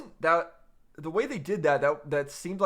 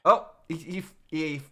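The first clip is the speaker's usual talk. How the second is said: ordinary talk, nothing marked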